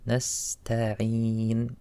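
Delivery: "Nasta'een" with a thin "tae"; light ta. Shown as a demonstration. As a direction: stretch "Nasta'een", keeping the t light. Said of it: "Make the letter light".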